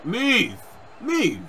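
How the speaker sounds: high, impatient call to move; urgent command. Real, not acted. Gamer telling a wrestler in his way to move, urgent high cry. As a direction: cry it out urgently, falling at the end.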